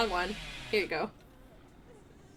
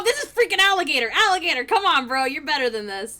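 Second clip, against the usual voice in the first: ordinary delivery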